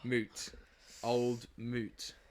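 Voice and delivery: "Moot", with a long oo like boot; "moot" rhymes with boot. Shown as a demonstration. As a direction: spell out the sound in "Old moot": oo, no L. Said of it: "it is in fact pronounced as M O O O O T".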